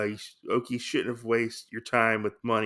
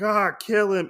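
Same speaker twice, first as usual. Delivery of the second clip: high, louder villain's order; mock attack cry; 10 semitones higher, 5 dB louder than usual. Retold agents springing their trap, mock attack cry. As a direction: call the attack in mock menace.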